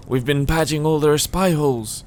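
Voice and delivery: put-on old man slipping into Irish; slightly Irish lilt, unintended; accidental Irish accent. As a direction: let a hint of Irish creep in. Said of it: "I'm getting Irish all of a sudden".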